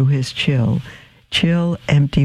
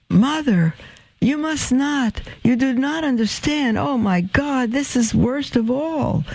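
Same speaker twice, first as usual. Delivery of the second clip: anguished pleading; 11 semitones higher than usual. Acted. Son pushing his mother away, high anguished plea. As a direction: beg her to stop, ashamed.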